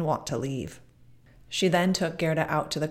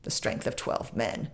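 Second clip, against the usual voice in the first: low, softer, unvoiced scoffing murmur of "The strength of twelve men"; scoffing repeat; 7 semitones lower, 5 dB quieter than usual. A narrator's reading. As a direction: mutter it back with scorn. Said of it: "said the woman".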